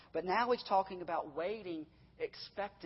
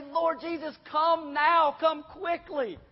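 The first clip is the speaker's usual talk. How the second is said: high, loud impassioned cry; urgent prayer; 10 semitones higher, 8 dB louder, slower than usual. Acted. Preacher calling on Jesus to return, urgent plea. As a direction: plead at full force, slowing at the end.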